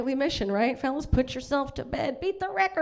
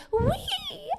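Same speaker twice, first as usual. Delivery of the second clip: high playful squeal; mock gleeful squeal. Comic mocking ladies at it, high squeal. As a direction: squeal it like you're having fun. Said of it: "We're like".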